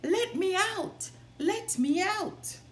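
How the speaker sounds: high, loud calls from inside; calling out. Acted. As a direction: cry out to be freed. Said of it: "a voice called".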